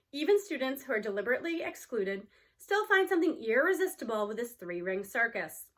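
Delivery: ordinary delivery, nothing marked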